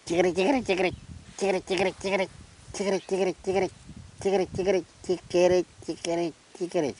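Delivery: willy wagtail call. Shown as a demonstration. Showing the willy wagtail's sound, high chattering call. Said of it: "Willy Wagtail".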